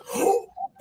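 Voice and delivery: sharp, voiceless imitation gasp; mock gasp. Coworker realizing the camera watches, imitated shocked gasp. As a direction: suck in air like she's caught. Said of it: "And she's like".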